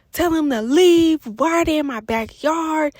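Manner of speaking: hollering at her friend on the phone; retold screaming. On the phone, hollering at her friend to get them out. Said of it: "I was screaming and hollering at her".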